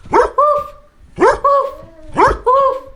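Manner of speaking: high, puffed woofs; dog bark. Pretend. Teacher asks children to bark along, high dog barks. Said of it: "Let's bark like a dog".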